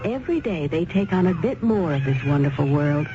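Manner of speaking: ordinary talk, nothing marked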